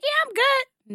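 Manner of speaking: put-on high, breezy drunk brush-off; squeaky tipsy "I'm fine"; drunk girl voice. Imitating drunk friend brushing off.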